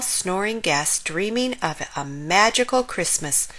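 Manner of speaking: ordinary delivery, nothing marked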